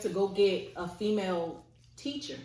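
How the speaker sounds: usual speaking voice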